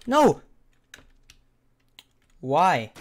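loud outburst of dismay; frustrated yell. Real, not acted. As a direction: cry out at being killed. Killed in the game.

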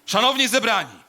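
loud ceremonial opening, pitch reined in; solemn, projected orator's call. Acted. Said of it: "niższym, dobrze".

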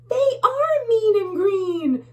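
high, loud frightened bug; little bug. Acted. Little bug crying out about the eyes, high and loud. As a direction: squeal it like a tiny bug. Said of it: "says one of the little bugs".

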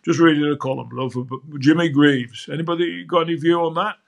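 put-on lightly done northern English accent; the boss's northern English accent, calling her love; a hint of northern English, quoting the executive producer. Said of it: "He was a northerner".

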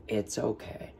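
soft whisper; a hushed reassuring whisper; whispered comfort. Acted. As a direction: whisper it gently to the boy. Comforting the crying boy.

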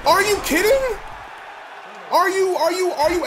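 yelling at another fumble; disbelieving yell. Real, not acted. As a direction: scream in disbelief at the screen.

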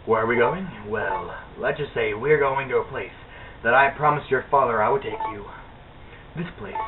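quiet reading. Acted. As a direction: softly read game dialogue. Reads game mother's dialogue.

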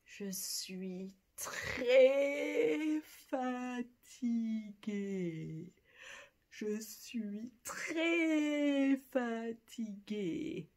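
slow, drooping je suis très fatigué; tired. Shown as a demonstration. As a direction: let très fatigué sag slowly. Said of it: "very tired".

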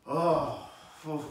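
oh, phew, blown out; moved sigh. Shown as a demonstration. Preacher showing the reaction to God's Word, a sigh. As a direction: let the word hit you, breathe out. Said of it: "made you go".

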